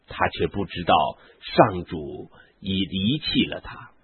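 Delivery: ordinary delivery, nothing marked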